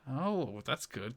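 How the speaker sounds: ordinary talk, nothing marked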